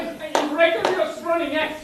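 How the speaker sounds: loud, high hyped-up yelling; hyped shout. Acted. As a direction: get the team fired up, yelling. Teammate overhyped pep talk.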